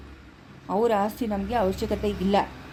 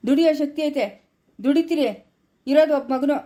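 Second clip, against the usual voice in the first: louder, higher, in short bursts; firm scolding; 4 semitones higher, 4 dB louder than usual. Acted. Husband rebuking wife over property greed, raised and firm. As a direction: lay it down point by point.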